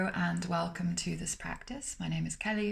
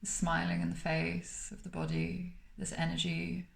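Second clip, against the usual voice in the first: calm, unhurried meditation voice with long pauses between phrases; guided meditation; 4 dB quieter than usual. Acted. Meditation teacher guiding listeners to smile through the body, calm and spacious.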